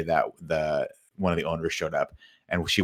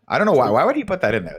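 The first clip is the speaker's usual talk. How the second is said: baffled; 8 semitones higher, 6 dB louder, faster than usual. Real, not acted. Puzzled at the movie ending.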